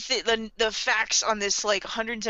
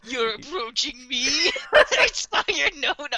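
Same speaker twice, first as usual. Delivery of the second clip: laughing, slow mock Dio line; Dio quote; 5 semitones higher, 5 dB louder, slower than usual. Quoting the anime villain line to a friend, laughing hammed-up. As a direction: ham up the villain's challenge. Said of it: "the Dio line".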